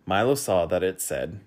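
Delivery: usual speaking voice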